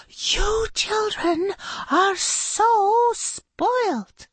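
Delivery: very high, very slow exasperation; high, drawn-out scolding teacher; exasperated. Acted. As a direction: drag it out in outrage. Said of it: "too angry".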